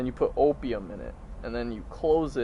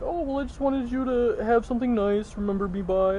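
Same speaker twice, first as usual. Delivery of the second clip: higher, mock-sweet motherly tone; sweet mom voice; 9 semitones higher than usual. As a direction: imitate mom offering a gift fondly. Mom voiced in retold story.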